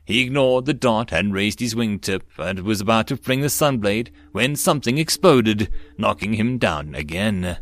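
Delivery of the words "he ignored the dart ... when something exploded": ordinary delivery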